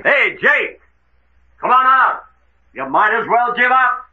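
high, loud holler to surrender; projected high shout across the deck; shouted challenge. Acted. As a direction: call out to a man in hiding. Calling hidden man to surrender.

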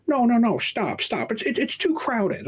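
high, fussy mock of a protesting old lady; shrill mock old lady. Mocking the old lady blocking the monorail, high and fussy.

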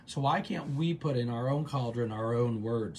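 ordinary delivery

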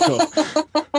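high squeaky okay cool; relieved squeak. Retold or imitated. Driver relieved he is not in trouble, high squeaky reply.

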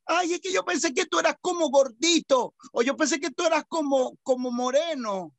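high, exaggerated surprised fan; gushing acquaintance. Host mimicking people meeting an announcer, high gushing. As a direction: voice a let-down admirer, pitched high.

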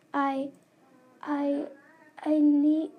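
halting, broken words, pushed out; stammering in fear. Acted. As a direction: stammer it, scared. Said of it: "she stammered in fear".

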